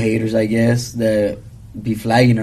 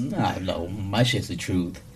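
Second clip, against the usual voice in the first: much softer, low and slow; quiet; 5 semitones lower, 10 dB quieter, slower than usual. Real, not acted. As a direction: say it low, almost to yourself. Denies being full of it.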